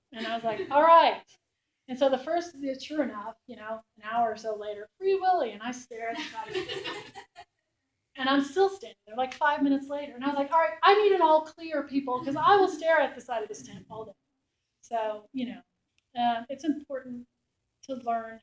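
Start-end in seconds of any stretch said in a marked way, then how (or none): 0.7-1.2 loud, higher all right; loud mock resignation. Reporter giving in to the sergeant, loud. Agreeing to the code word. 5.0-5.5 loud, higher shouted code word; mock call-out. Soldier calling the code word in the tent, loud. 10.5-14.1 loud, higher call across the tent; loud mock call-out. Reporter calling to soldiers for an all clear, loud.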